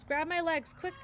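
robot urging the bears to grab her legs; urgent. A narrator's reading. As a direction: bark it out urgently. Urgent rescue command.

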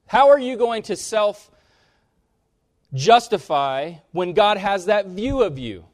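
loud, high, slowed preaching; fervent preaching. Real, not acted. Preacher challenging self-justifying listeners, loud and high. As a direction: hammer the point home to the room.

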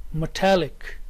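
"metallic" with the beat on "tal"; stress on -tallic. Shown as a demonstration. Teacher models the third word, stress on -tallic. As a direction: say "metallic" with a light "me" and weighted "tallic". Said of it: "primary stress is here on talic".

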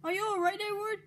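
high-pitched worried question; concerned cry. A narrator's reading. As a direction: call out high to check on a hurt friend. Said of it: "cried Henry".

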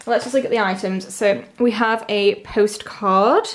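ordinary delivery

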